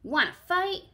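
high, loud little bug call; cranky ladybug calling out; grouchy ladybug. Acted. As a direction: bark it like a cranky tiny bug. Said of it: "said the grouchy ladybug".

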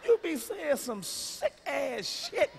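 slow punchline. Acted.

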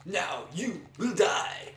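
slow, loud villain shout; booming villain line. Retold or imitated. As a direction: drag out a cheesy movie villain threat. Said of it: "The bad line she says".

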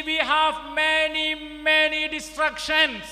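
loud, high fiery sermon voice; full-force preaching. Real, not acted. Preacher warning the Chennai congregation: a loud fiery sermon. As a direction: thunder it at the crowd.

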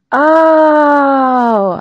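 long, high, falling cry; loud realization. Acted. Daughter finally remembering her half birthday, loud realization. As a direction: draw it out loudly as it dawns.

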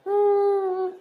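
whimpering cry breaking into sobs; sobbing laugh. Acted. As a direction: let the sob spill out. Daughter breaks down crying.

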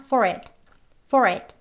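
"for it" joined as one word, "fo-rit"; short, connected "for it"; linked sounds. Shown as a demonstration. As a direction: carry the r of FOR into IT. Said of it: "소리가 연결되어서".

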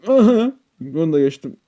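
high loud aha of triumph; triumphant aha. Real, not acted. Player clears a stage, loud high triumphant aha. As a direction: crow it as you clear the jump. Reacting after passing.

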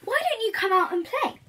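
high, loud coaxing wolf calling into the cave. Acted. Wolf coaxing the little creature out to play, high and loud. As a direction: sweet-talk the creature out, pitched high. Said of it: "asked the wolf".

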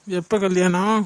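loud, sudden 'when?'; startled question. Acted. Husband to wife on hearing of the wedding, loud surprised blurt.

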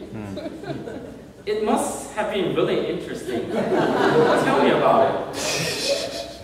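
teasing through laughter, rising high into giggles; best friend teasingly laughing as he pries. Acted. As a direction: chuckle while prying for the secret. Friend teasing about the dream.

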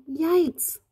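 high-pitched startled exclamation; storybook alarm. A narrator's reading. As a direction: exclaim it as if something is scary. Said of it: "When something that you go".

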